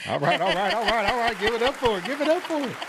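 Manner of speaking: rising, high hype-man call; calling for applause. Real, not acted. Host cheering a round's player to the audience, excited rising call.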